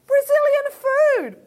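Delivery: high, drawn-out duck voice; ducks answering back. A narrator's reading. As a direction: stretch it out at a high pitch, like a duck. Ducks answer the rude piranha.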